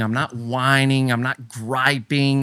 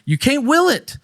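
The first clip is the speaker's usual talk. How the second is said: self-mocking exasperation; 13 semitones higher, 4 dB louder than usual. Real, not acted. Mocking his own failed effort.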